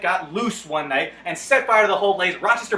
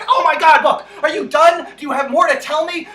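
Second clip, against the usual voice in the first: fed up with the endless book, let it burst out; exasperated outburst; 7 semitones higher, 8 dB louder than usual. Retold or imitated. Saint John loses patience with the plodding book, loud exasperation.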